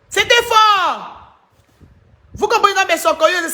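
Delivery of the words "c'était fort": drawn-out high exclaim. Real, not acted. Streamer marveling to her fans, slow high exclaim. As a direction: stretch it out slowly, pitched up high. Reacting to the video on live.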